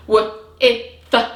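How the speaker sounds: "W, e, th" as separate short phonics sounds; "e" as short "ih" between "W" and "th"; sounded out. Shown as a demonstration. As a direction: say "W, e, th" one sound at a time, with gaps. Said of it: "ready to stretch it out".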